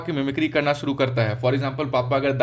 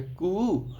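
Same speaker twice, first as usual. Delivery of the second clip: long soft imitated coo; baby coo; 3 semitones higher, 5 dB quieter than usual. Teacher showing a baby's first sound, cooing. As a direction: coo like an infant. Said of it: "बच्चा पहले आवाज़ निकालता है".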